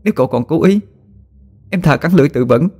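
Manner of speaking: high, pleading, firm refusal; beg, then refuse him with resolve; desperate resolve. A narrator's reading. Wife refuses her possessed husband, pleading but firm. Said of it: "quả quyết nói".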